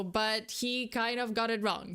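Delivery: usual speaking voice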